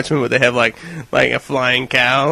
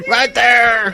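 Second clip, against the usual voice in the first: high, eager creature cry; hammed-up high plea; begging locusts; 8 semitones higher than usual. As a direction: call out high as a thrilled monster. Continues the locust voice.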